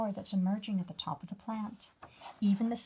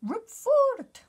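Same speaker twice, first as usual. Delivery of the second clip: very high, loud bug yell; bug shouting; 13 semitones higher, 11 dB louder than usual. Acted. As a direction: yell like a tiny bug on a swing. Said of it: "shouting as he's swinging".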